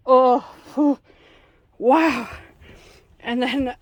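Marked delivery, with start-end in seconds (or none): 0.1-2.3 loud disgusted whew; blowing out after a bad smell; smelling something awful. Real, not acted. Reacting to fridge smell.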